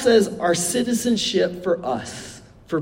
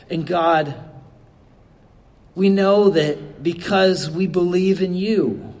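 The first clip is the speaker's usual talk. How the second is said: normal talking voice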